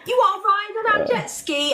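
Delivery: high put-on English accent, deadpan offer; mock English accent. Girls approaching strangers at schoolies, fake English accent. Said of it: "We'd just be like".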